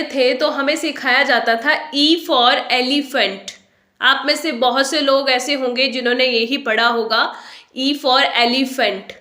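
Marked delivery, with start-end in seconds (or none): 2.7-3.5 elephant as EL-ee-fent, clear ee in the middle; common mispronunciation. Shown as a demonstration. Teacher quoting how school taught it, elephant as EL-ee-fent. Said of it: "लेकिन आप इसे एलिफेंट pronounce नहीं करेंगे". 7.8-9.1 elephant as EL-ee-fent, spelled-out vowels; common mispronunciation. Shown as a demonstration. Teacher recalling the school chant, elephant as EL-ee-fent. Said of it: "ये गलत है".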